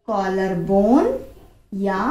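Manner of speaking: ordinary talk, nothing marked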